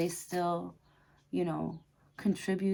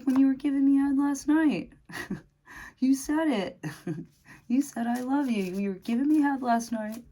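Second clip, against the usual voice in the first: high, loud protest cracking into laughter; mock indignant protest; 8 semitones higher, 7 dB louder than usual. Reader playing a lover reminding the other what was said, mock indignant. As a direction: protest loudly, voice pitched way up, half laughing.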